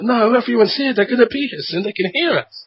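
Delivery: put-on high-pitched, desperate kid plea; squeaky, insistent little-kid protest; protesting child. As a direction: imitate a child refusing to leave. Child protesting while dragged away.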